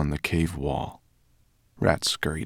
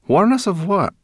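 much higher, loud and mocking; mocking brownie; 13 semitones higher, 7 dB louder than usual. A narrator's reading. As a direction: jeer at her. Brownie mocks.

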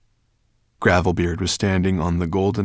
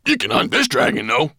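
high, loud dwarf's call; shouted suggestion; 8 semitones higher, 8 dB louder than usual. A narrator's reading. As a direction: call up eagerly from a distance. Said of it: "Gravelbeard called from Nettlebrand's back".

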